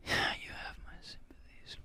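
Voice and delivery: whispered under breath; whispering. Real, not acted. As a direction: whisper it to yourself. Repeats quote to himself.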